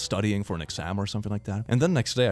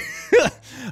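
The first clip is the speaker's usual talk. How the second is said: high laugh; 6 dB louder than usual. Real, not acted. Laughing at the date exchange.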